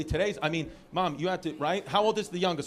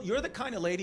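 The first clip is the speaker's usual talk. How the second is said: usual speaking voice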